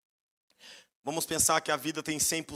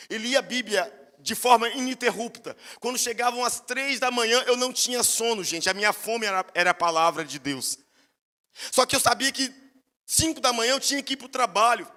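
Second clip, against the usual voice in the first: fervent testimony; 6 semitones higher, 4 dB louder than usual. Real, not acted. Preaching fervour.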